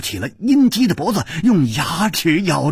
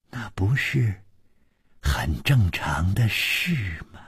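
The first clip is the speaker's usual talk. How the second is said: coy taunting; 5 semitones lower than usual. A narrator's reading. Woman provoking her companion about a man, coy taunting. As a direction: draw it out coyly, dropping to a near whisper. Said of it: "眨着眼睛说".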